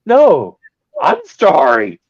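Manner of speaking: high, loud panicky begging; mock pleading. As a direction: beg for mercy in hammed-up terror. Begging the giant, retold.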